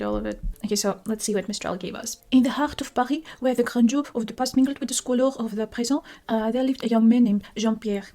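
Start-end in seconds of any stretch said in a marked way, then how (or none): 2.3-8.0 put-on lightly French-accented reading, Paris rolled and r's guttural. Reading the chatbot's story aloud in a put-on French accent. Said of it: "I feel like I have to read it in a French accent".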